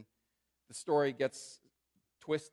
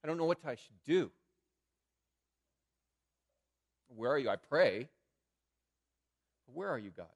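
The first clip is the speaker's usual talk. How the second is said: louder, pleading cry of where are you; frustrated outcry; 3 semitones higher, 10 dB louder than usual. Pretend. Preacher voicing a hurting believer crying out to God, louder and frustrated. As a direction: demand an answer from God, exasperated. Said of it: "where you just say".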